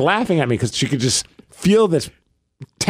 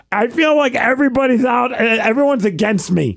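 worked-up, higher-pitched mock yell; retold yelling; 7 semitones higher than usual. Husband recounting his outburst at his wife, retold yelling. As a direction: protest at a higher pitch, mildly yelled. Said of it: "I'm yelling at her".

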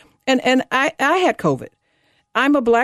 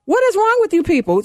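exasperated outburst; 10 semitones higher than usual. Real, not acted. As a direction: throw up your hands at them. Exasperated rant about mask wearers.

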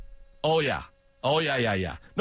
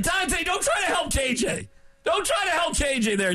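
mock scolding; 11 semitones higher than usual. Real, not acted. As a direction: playfully tell him off. Host scolding producer jokingly.

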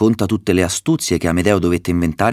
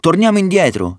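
very high-pitched, urgent counter-call; insistent cry; 11 semitones higher, 4 dB louder than usual. A narrator's reading. Ulisse opposes Roderigo at the veil, insistent high cry. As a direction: cry it out against going on.

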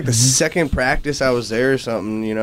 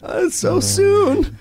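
laughing; much slower than usual. Real, not acted. Mock reaction while laughing with friends.

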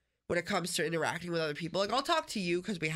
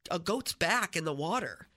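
ordinary talk

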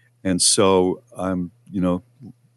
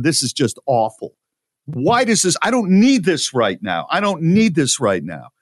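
higher, exasperated retold outburst; really upset; 7 semitones higher than usual. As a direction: let the frustration spill over. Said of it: "Now I'm really upset".

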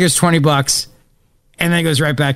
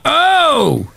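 triumphant shout; 10 semitones higher than usual. Real, not acted. Self-celebration.

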